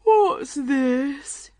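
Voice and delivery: big, loud, high-pitched yawning question; weary lion heaving out the words; sleepy lion. A narrator's reading. Lion noticing Alice for the first time, tired and sleepy. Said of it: "He looked very tired and sleepy".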